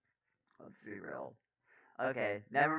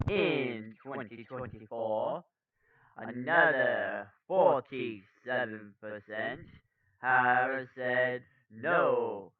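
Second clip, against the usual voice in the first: slow, emphatic news-anchor delivery; news read; 12 dB louder, much slower than usual. Acted. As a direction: punch key words, lingering on figures.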